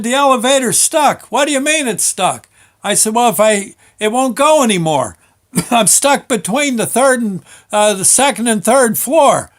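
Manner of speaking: loud, high retold shouting. Stuck worker shouts his situation down to the foreman, loud. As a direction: call it down the shaft, flustered.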